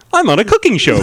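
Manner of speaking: cooking show host. Retold or imitated. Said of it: "a real pleasant ... voice".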